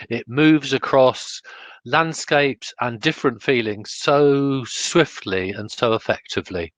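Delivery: ordinary talk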